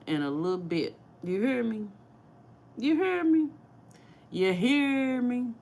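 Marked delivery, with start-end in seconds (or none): 1.2-5.5 put-on playful Saint Louis accent, higher and rising in pitch. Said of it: "That's my Saint Louis accent".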